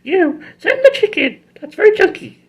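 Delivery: very high witchy command; high villain voice. Retold or imitated. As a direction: order it like a shrill cartoon villain. Rita orders chicken.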